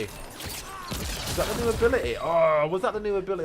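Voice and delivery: high, loud thrilled yelps; excited shouts. Real, not acted. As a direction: cheer it out, really hyped. New ability thrills him.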